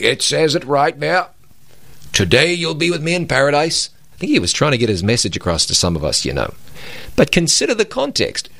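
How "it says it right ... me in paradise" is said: a hint of a pulpit preacher, insistent; imitating emphatic preacher. [name] recalls a funeral preacher, mildly emphatic imitation. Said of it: "He was emphatic".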